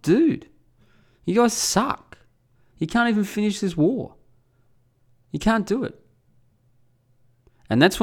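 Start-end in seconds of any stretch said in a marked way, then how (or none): 0.0-6.0 high-pitched taunt; mocking. Rubbing it in after he got upset, high mocking. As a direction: needle him, voice pitched well up. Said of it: "I just started mocking him openly".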